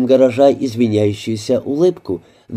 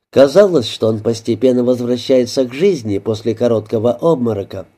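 ordinary delivery, nothing marked

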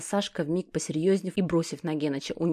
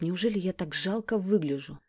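usual speaking voice

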